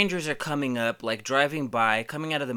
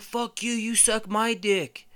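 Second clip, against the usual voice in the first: high-pitched, drawn-out retort; mock yell, throwing the insult back; retold yelling back; 11 semitones higher, slower than usual. Man answering a heckler in the park, yelling back. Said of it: "we're yelling back at him".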